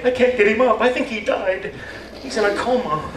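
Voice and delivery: high, mock-worried spouse; spouse's worry. Spouse the next morning finding him asleep, high mock worry. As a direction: fret that he won't wake up. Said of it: "Your spouse the next day".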